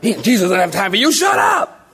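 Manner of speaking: high-pitched mock outburst, hurried in the middle; acted scolding. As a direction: snap at a nuisance, hammed up. Religious folk scold the blind men.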